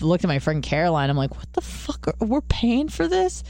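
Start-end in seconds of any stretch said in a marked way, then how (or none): 1.4-2.1 whispered aside; hushed disbelief; whispering. Retold or imitated. Aside to friend in parlor. 2.1-3.4 high alarmed. Retold or imitated. Alarmed retelling to friend.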